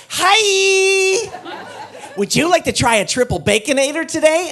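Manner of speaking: high, loud, overeager fast-food cashier; hammed-up cheery counter guy greeting; chirpy cashier. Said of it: "the guy behind the counter went".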